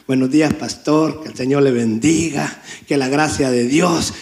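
low-pitched, meek, pious greeting; mock humble. Churchgoers asking pastor for advice, imitated as very humble. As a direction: put on exaggerated humility, keeping the voice down. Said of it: "vienen bien humildes".

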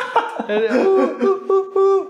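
loud high-pitched laugh; high laughter burst. Real, not acted.

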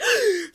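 a big loud imitated gasp of shock. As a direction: gasp loudly like a shocked friend. Friends react to cut hair.

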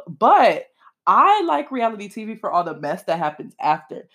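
ordinary talk, nothing marked

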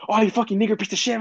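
high, fast mock rant; cursing kid. Angry kid cursing after losing, fast high rant. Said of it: "But then he was like".

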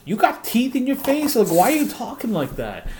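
high, mocking imitation of a twangy girl; hammed-up high mocking voice; mocking a country girl. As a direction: squeak it out like you're poking fun at her. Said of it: "there's ones that are like".

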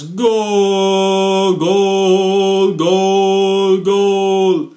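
long, high, sustained commentator shout; goal call. Pretend. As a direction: belt out goal like a Latin announcer.

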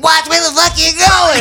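high mock yell of an angry small man; imitated angry yell. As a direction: shout it back like an angry pedestrian. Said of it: "he gave me the finger and he's like".

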